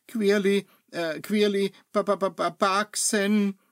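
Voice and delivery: nervous broken stammer; panicked apprentice stammering the spell; stuttering. Acted. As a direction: stumble over each syllable. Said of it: "stotterte er".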